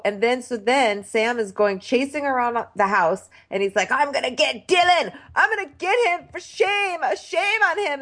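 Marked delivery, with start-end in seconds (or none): 3.9-8.0 much higher, half-shouted rant; mock screaming. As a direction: rant like a hammed-up tantrum. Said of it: "he's screaming".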